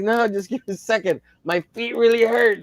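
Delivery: high, strained protest; retold pained plea. Hurt man answering fussing onlookers, pained pleading.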